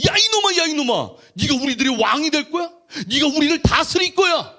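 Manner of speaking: loud, high-pitched angry shouting; furious brothers. Acted. Older brothers confronting Joseph over his dream, furious shouting. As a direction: yell indignant challenges at an upstart. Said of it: "열받는 거예요".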